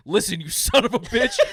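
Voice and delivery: hammed-up angry Biden, laughing; mock Biden snapping. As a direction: play an old man losing his temper. Imitating Biden yelling.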